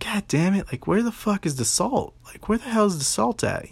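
high-pitched mock frustration; retold griping while hunting for something lost; exasperated. Retold exasperated hunt for the salt.

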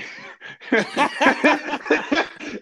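loud, high burst of laughter at his own joke; laughing hard at his own punchline; big burst of laughter. Real, not acted.

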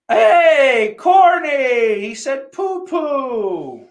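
high, loud whooping call; playful high-pitched holler; hollered greeting. Pretend. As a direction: shout a hello, stretching the last words out high.

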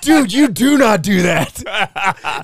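high, loud, slow reprimand; mock appalled shout. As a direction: act out shock, drawing out each word. Retold shocked scolding after punch.

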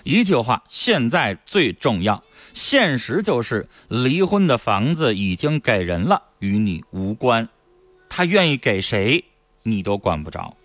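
slow, measured on-air read of a listener's words; reading a listener's message. Acted. Radio host reading a listener's WeChat message to the caller, slow and measured.

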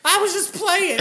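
very high mock wail; hysterical girlfriend imitation. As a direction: wail the excuse like a girl in a panic. Voicing the hysterical girl.

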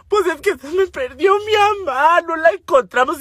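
lloriqueo agudo; explícale al vecino como llorando a mares; llanto actuado. Retold or imitated. (queja llorosa, aguda y exagerada)

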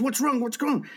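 high-pitched urgent questioning; alarmed doctor. Acted. Doctor to the husband coming out, alarmed. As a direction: act out a doctor's sudden alarm.

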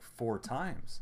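usual speaking voice

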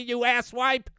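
normal talking voice

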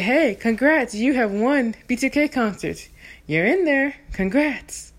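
loud upbeat radio host; announcer voice. Retold or imitated. Prank caller posing as radio host, loud and upbeat. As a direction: hype it like a DJ.

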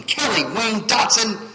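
loud, high, drawn-out full name; scolding mom. Retold or imitated. Preacher imitating Mom when he messed up, scolding. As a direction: imitate a mother calling out the full name, slowly. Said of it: "and Mom saying".

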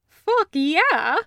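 loud, high, grinning whoop of approval; enthusiastic cheer. Acted. Taco cheering the snack cakes, loud grinning enthusiasm. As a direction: punch it out like a delighted soldier. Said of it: "he says, grinning".

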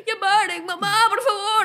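put-on high-pitched child's pleading; whiny little sister. Little sister begging mum in the car, high whiny plea. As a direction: imitate a kid whining to mum, drawn out. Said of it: "si queríamos hacer llorar a mi hermana menor".

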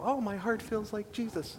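high, soft, airy-fairy piety; mock ethereal. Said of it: "it's not all about this ethereal".

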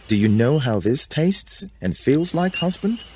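high, coaxing question; tempting Eve. A narrator's reading. Eve holding fruit to Adam's mouth, high-pitched tempting. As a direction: tempt him with a rising high voice.

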